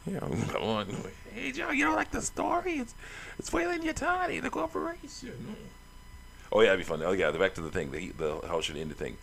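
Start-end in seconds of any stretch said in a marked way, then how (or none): 1.3-5.6 very high character voice; put-on squeaky voice. Player teasing Joe about the story, squeaky voice. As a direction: pitch it way up, like a little cartoon figure.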